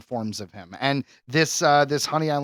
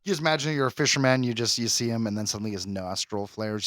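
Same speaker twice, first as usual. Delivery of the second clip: normal talking voice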